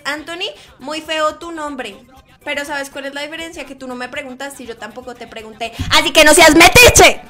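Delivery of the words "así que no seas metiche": sudden very loud telling-off; scolding shout. Acted. Streamer tells off nosy viewer, scolding shout. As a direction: blast the nosy commenter at full volume.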